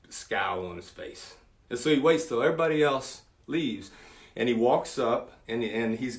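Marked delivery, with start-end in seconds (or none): 0.1-1.4 hushed aside. Retold or imitated. Setting up a scowling kid.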